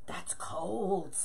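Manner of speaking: soft whisper, breath only; whispered aside. Real, not acted. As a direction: whisper it like a sympathetic shiver. Reacting to a viewer's cold weather.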